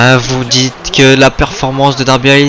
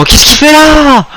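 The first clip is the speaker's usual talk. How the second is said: high stretched shout; shocked shout; 13 semitones higher, 4 dB louder, slower than usual. Real, not acted. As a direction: yell in disbelief. Shock at the move.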